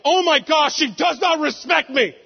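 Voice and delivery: high, hammed-up indignation; mock outrage. Dad mocking his overreaction to his daughter, mock outrage.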